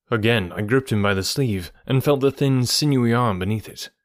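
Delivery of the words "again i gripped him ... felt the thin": plain reading voice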